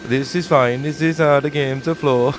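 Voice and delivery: lightly put-on Eastern European accent for the dev; a hint of Eastern European accent, mocking. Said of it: "I don't know why I've made him Eastern European".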